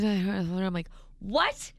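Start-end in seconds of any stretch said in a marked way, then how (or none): 1.2-1.7 high, shouted What? over noise; mock shouted what. Can't hear over movie.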